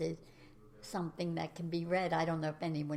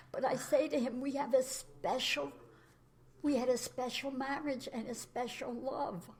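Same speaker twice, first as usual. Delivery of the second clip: higher, tear-choked voice; teary; 6 semitones higher than usual. Real, not acted. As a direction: speak through rising tears. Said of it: "I'm starting to sound teary".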